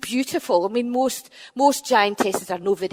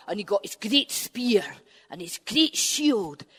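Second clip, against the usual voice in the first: ordinary delivery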